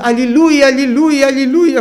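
loud, high repeated cries of joy; shouting hallelujah. Retold or imitated. Old woman greeting the young preacher, joyful shout. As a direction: cry out hallelujah over and over. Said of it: "και φώναζε".

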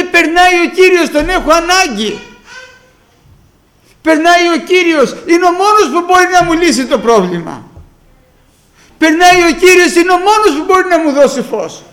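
loud, high-pitched, insistent declaration; urgent calling. Acted. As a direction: proclaim it like your one chance is passing. Beggar's urgent need, preached aloud.

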